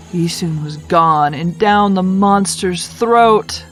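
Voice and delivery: plain reading voice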